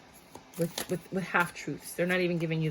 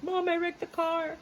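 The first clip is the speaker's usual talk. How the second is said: put-on higher, slow, sheepish kid confessing; panicked kid on the phone; 10 semitones higher than usual. Kid calls mom confessing a wrecked car, sheepish and high. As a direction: play a guilty kid calling home. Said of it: "somebody called and they were like".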